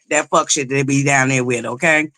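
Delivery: usual speaking voice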